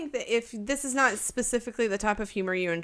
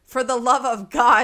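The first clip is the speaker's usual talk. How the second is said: exasperated plea; 3 semitones higher, 10 dB louder than usual. Real, not acted. Begging listeners for reviews.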